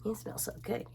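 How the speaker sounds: unvoiced hushed whisper; soft whispered you smell so good; whispered compliment. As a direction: murmur it in awe.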